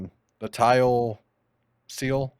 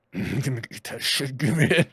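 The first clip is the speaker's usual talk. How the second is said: greedy talking toilet. Pretend. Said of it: "either he's like".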